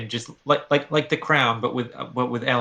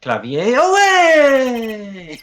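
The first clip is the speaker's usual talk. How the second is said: higher, drawn-out grand call; dramatic exit; 11 semitones higher, 6 dB louder, much slower than usual. Acted. Charmer leaves on the wind, slow and grand. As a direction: sweep off stage with flair. Gavin rides off.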